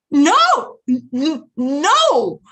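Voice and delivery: high, loud stammered no; panicked denial. Retold or imitated. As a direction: stumble over the no, caught out. Caught mom stammering denial.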